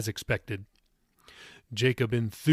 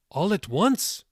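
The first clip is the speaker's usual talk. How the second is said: higher-pitched astonished question; surprised; 7 semitones higher, slower than usual. A narrator's reading. Tilda asks Jacob about his big plans, surprised. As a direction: ask it with lifted surprise.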